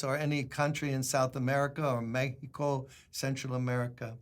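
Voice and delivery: ordinary talk, nothing marked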